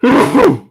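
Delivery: sudden loud real sneeze. Real, not acted. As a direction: sneeze hard into your elbow. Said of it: "I think I'm gonna sneeze".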